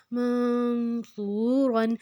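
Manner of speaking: slow منصورن, n held as a nasal hum; nasal ghunna. Shown as a demonstration. Teacher shows sound through the nose, long nasal n in منصورن. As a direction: send the n of منصورن into the nose, then stretch "soo". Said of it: "ناک میں تھوڑی آواز لے کے".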